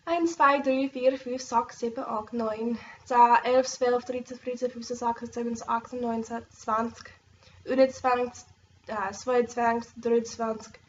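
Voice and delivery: counting in Swiss German, steady recital. Shown as a demonstration. Vlogger demonstrating learned Swiss counting, pronunciation. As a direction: show off the Swiss number words. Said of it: "I'm learning Swiss German".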